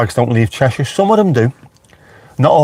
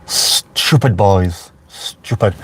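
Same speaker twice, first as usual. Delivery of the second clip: slow, disapproving tut at the rioters; drawn-out scolding, shaking the head; 3 semitones lower, much slower than usual. Real, not acted. Vlogger scolding local rioters, slow and disapproving.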